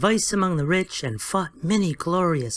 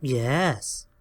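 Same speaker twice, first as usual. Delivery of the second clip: positively; 5 semitones lower than usual. A narrator's reading. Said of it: "said Happy positively".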